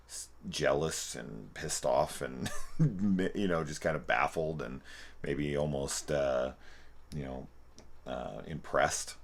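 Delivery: ordinary delivery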